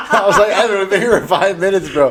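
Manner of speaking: laughing at how fast Evan wanted to leave the seminar. Real, not acted. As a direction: crack up while telling it.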